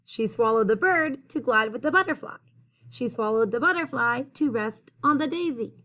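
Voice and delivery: lively storytelling, climbing high on the last line; building rhyme read-aloud. A narrator's reading. Reader carrying children through the repeated rhyme, building read-aloud.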